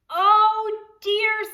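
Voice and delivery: high, loud oh dear; a big theatrical dismay. A narrator's reading. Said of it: "said Ma".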